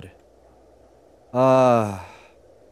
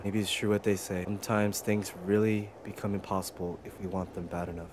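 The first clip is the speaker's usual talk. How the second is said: softer, lower heartfelt goodbye; tender farewell; 7 semitones lower, 13 dB quieter than usual. Real, not acted. Player thanking his pet rat Roddy, soft tender farewell. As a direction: say goodbye to a dear friend quietly.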